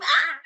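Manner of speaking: sudden high-pitched story scream. A narrator's reading. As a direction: let out a short, acted high scream. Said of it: "and she screamed".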